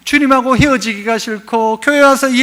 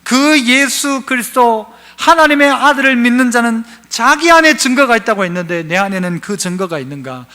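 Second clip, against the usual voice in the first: ordinary talk, nothing marked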